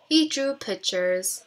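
ordinary delivery, nothing marked

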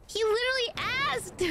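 normal talking voice

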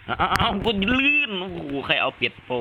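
high-pitched alarmed cry of slipping; startled yelp. Real, not acted. Player slipping on a wet floor in game, startled yelp. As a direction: yelp in sudden dismay, pitch shooting up.

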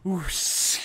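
rushing airy whoosh; an ooh breaking into hissing air; imitated wind whoosh. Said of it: "This is like".

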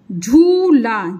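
झूला with a long held "oo" and open "aa"; drawn-out ऊ. Shown as a demonstration. Teacher showing where ऊ sits in झूला, both vowels stretched. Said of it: "झा से ऊ का sound आ रहा".

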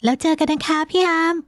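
put-on high-pitched young woman's voice; young woman's farewell. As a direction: bid him goodbye in a high feminine voice. (เสียงหญิงสาวสูง กล่าวลา)

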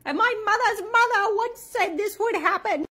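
high, loud, theatrical witch voice. Pretend. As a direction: cackle it like an old witch. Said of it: "my little witch voice".

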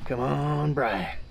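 soft unvoiced cheer; whispered encouragement. Real, not acted. Whispering to Brian to urge him on. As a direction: whisper him on under your breath.

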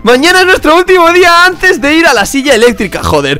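a hint of a worried inmate planning escape; prisoner roleplay. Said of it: "¡Estamos haciendo roleplay!".

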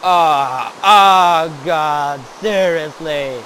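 loud pained yell; loud outburst. Real, not acted. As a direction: cry out as if hurt. Hurt in game, screams.

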